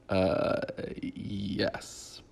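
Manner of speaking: mock hesitant, hushed admission; quietly drawn-out, awkward confirmation; reluctant yes. She awkwardly tells him he's going home, hesitant and hushed.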